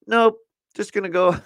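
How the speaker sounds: clueless chirpy reply. Retold or imitated. Playing himself turning down the girl, high and loud. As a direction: cheerfully brush it off.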